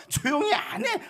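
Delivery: high, loud telling-off; scornful rebuke. Retold or imitated. Crowd silencing the blind beggar, acted angry rebuke. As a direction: imitate a contemptuous person snapping for silence. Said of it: "막 무시하고 꾸짖는 거예요".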